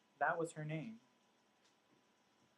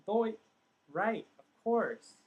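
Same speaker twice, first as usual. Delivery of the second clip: loud, higher, drawn-out "of course"; sudden realization; 7 semitones higher, 9 dB louder, much slower than usual. Retold or imitated. Father answering his daughter in a dream, sudden realization. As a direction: act out it all clicking, slow and emphatic.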